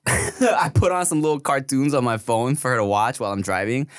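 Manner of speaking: normal talking voice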